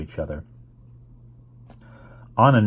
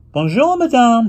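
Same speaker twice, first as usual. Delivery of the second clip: bright greeting; 8 dB louder than usual. Acted. Anna greets concierge in French.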